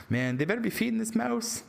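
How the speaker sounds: lightly hammed worry for the lab mouse; mock worried. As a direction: fuss over the poor mouse.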